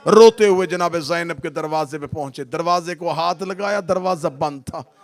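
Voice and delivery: very loud, high wailing preaching over music; wailing sermon. Acted. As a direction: wail the tragedy to the crowd at full force. Majlis wailing at full force.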